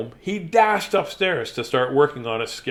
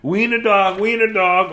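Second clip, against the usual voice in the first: taunting laugh; 5 semitones higher, 5 dB louder than usual. A narrator's reading. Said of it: "laughed all the other dogs".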